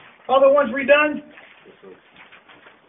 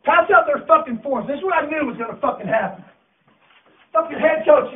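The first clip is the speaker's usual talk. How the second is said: ordinary talk, nothing marked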